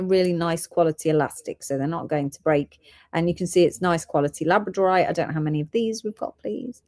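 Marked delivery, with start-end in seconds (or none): none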